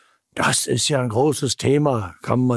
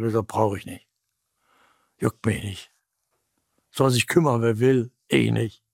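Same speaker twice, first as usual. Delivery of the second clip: low, offhand mock indifference; dismissive shrug; 3 semitones lower than usual. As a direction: toss it off like you couldn't care less. Acts out someone scorning the gospel.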